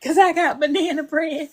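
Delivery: sweetly offering food; emotional auntie. Retold or imitated. Voicing the emotional auntie offering banana bread, mild. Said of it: "emotional auntie who be like".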